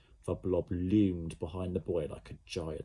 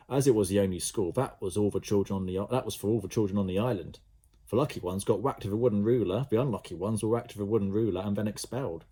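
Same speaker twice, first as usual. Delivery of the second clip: ordinary delivery, nothing marked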